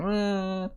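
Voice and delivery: high, soft, drawn-out pretend cry; mock child's wail. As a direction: imitate a toddler starting to bawl. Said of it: "oh, he starts crying".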